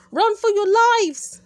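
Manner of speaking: loud, high, stretched-out yell to flee; panicked shout. Acted. Boy to friends, shouting to flee the blast. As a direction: scream at everyone to run. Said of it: "cried Harold".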